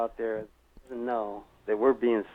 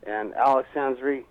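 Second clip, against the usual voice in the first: usual speaking voice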